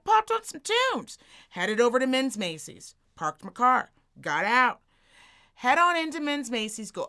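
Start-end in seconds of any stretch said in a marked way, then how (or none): 0.0-1.2 high, drawn-out, gleeful delivery; playful high repeat. Retold or imitated. Storyteller reliving a short drive with music, playfully high. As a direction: stretch it out way up high.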